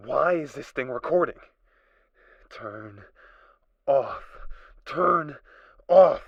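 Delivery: loud, high, frantic shouts; panicked yelling. Acted. Unhinged survivor shouts at the recorder to shut off, panicking. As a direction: yell at the machine to stop.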